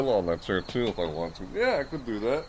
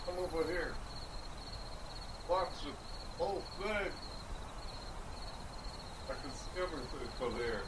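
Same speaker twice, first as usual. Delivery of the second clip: distant, much softer voice from across the room; far from the mic; 3 semitones higher, 12 dB quieter than usual. Real, not acted. Talking to chat from across the room, far off mic. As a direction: speak from far away, off mic.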